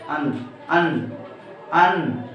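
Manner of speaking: "अन" drawn out and set apart; demonstrated anusvar sound. Shown as a demonstration. Teacher having students try the anusvar, "अन" spoken slowly in isolation. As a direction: sound out "अन" slowly, vowel first then nasal n. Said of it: "ये अनुस्वार बोल कर देखिए".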